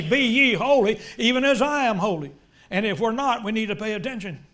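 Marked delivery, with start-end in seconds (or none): 0.0-2.3 louder, higher preaching of the command; loud commanding quote. Retold or imitated. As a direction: proclaim it with full force. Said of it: "He's telling you and me".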